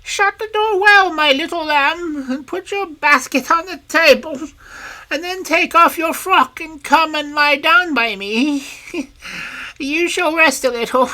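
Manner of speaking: put-on wolf luring the girl in a sweet granny voice; wolf disguised as grandmother coaxing the girl to bed; fake granny voice. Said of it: "softening his rough voice as best he can".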